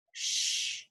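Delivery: soft unvoiced shush; hush. Cow hushing the others as the farmer nears, whispered. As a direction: hush them quietly.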